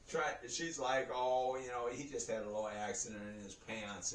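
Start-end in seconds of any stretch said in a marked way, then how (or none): 1.1-4.1 low, soft, discreet explanation; tactfully nice. Retold or imitated. Nurse covering for him to the receptionist, soft and tactful. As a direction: play it down gently, voice dropped. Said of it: "she's just trying to be nice".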